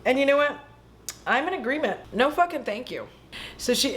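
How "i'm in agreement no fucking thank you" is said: ordinary delivery, nothing marked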